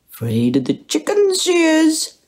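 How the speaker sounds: mock pet talk, high and playful. A narrator's reading. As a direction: tease the cat fondly. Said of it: "he said mockingly".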